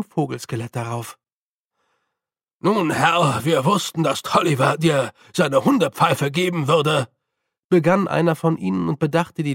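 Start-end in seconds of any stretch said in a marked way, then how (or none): 2.6-7.1 lightly voiced uneasy postman; a hint of a nervous order member. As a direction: address a superior while wary of his dogs. Postman speaks, eyeing the dogs.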